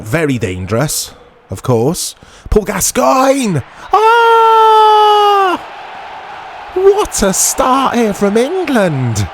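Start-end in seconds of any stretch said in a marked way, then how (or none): 2.5-9.3 mock commentator goal yell. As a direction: call the goal like a TV pundit going wild.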